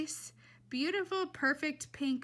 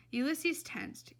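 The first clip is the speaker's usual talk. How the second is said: usual speaking voice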